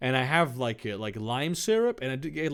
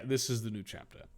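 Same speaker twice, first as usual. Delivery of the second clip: ordinary delivery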